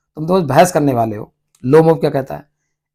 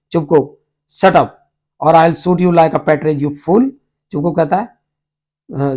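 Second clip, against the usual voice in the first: ordinary delivery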